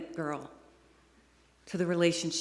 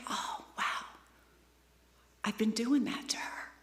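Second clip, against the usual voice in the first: hushed, breathy realization; stunned whisper; 3 semitones higher, 7 dB quieter than usual. Retold or imitated. Speaker realizing what she did to her daughter, whispering. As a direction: whisper it as the truth lands. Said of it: "It's like".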